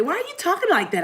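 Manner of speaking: high-pitched mock challenge; offended listener. Listener challenging her for imitating folk, mock-offended.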